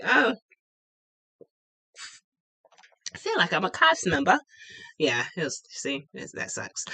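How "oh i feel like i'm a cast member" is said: put-on British accent. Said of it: "randomly speaking in British accents".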